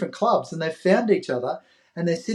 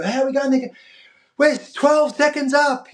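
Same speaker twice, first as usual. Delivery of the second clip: neighbours voiced; 11 semitones higher than usual. Neighbours asking the paperboy about the race, high excited voices. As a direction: play the eager locals asking the paperboy.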